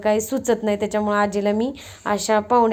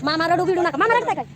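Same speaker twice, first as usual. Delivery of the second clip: much higher, sing-out teasing voice; playful teasing; 7 semitones higher, faster than usual. Real, not acted. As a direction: call it out high to tease uncle. Teasing uncle as guests leave. (चिडवत उंच आवाजात)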